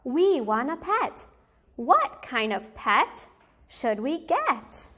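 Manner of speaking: usual speaking voice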